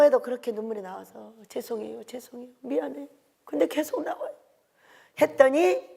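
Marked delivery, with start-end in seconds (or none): 1.5-4.3 tearful apology. Retold or imitated. Retold tearful apology to God.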